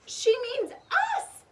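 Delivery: excited realization. A narrator's reading. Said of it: "said Annie".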